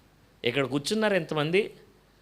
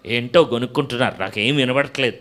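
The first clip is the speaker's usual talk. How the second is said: louder, dismissive grandma pretending not to hear; mock deaf grandma; 2 semitones lower, 8 dB louder than usual. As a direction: brush it off like a deaf granny. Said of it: "ఆవిడ వినకూడదు అనుకున్నది".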